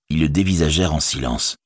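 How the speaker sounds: ordinary delivery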